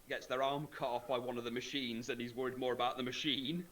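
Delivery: ordinary talk, nothing marked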